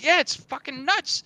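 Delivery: high, excited yeah; amazed agreement. Acted. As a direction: marvel at it.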